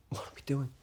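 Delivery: hushed, almost voiceless question; whispered question. Acted. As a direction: ask it under your breath. Same question repeated under the breath.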